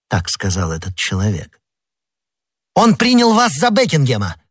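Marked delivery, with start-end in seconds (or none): 2.7-4.4 very high cry of sudden realization; astonished high-pitched exclaiming; excited exclamation. A narrator's reading. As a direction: burst out with the discovery, pitch leaping up. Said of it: "воскликнул Д'Артаньян".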